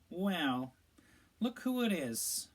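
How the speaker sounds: Malfoy sneering at Harry; lazy drawl. A narrator's reading. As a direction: drawl it out lazily, full of contempt. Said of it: "in his usual lazy drawl".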